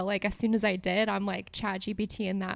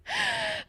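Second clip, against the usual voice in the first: high, helpless giggle; real laughing fit, high-pitched. Real, not acted. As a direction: laugh that won't stop. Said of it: "I can't stop laughing at this".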